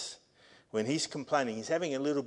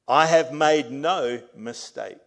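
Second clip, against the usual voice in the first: full-force preaching; 3 semitones higher, 12 dB louder, slower than usual. Acted. Pastor reading God's promise to the congregation, preaching at full force. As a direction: declare every line over the church. Quotes God, slow and loud.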